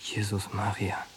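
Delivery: dismayed murmur. Acted. Al shocked by the story.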